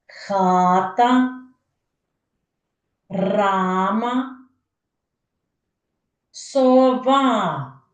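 хата, рама, сова with stretched vowels; long held syllables on хата, рама, сова; sounded-out examples. Shown as a demonstration. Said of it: "підберіть інші слова".

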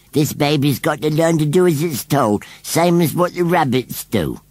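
high rustic scarecrow voice; stubborn rustic scarecrow. A narrator's reading. Scarecrow declares how baby will learn, stubborn. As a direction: lay down the law as a scarecrow.

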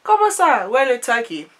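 high, loud Ivorian auntie, indignant. Retold or imitated. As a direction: play the baffled auntie, pitch way up. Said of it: "She's like".

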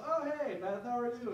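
high, cheery-sounding hello to an ex; lightly acted surprised greeting; retold greeting. Acting out greeting an ex.

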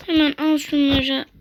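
ordinary talk

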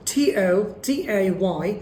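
ordinary talk, nothing marked

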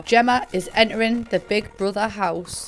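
ordinary delivery

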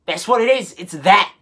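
normal talking voice

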